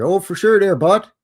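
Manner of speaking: loud, high hoser twang; Canadian accent. Pretend. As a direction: play up a Canadian buddy. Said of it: "Bunch of Canadians up in here".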